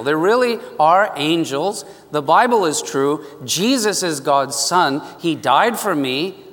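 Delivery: usual speaking voice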